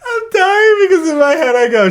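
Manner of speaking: laughing. Real, not acted. Laughing while telling story.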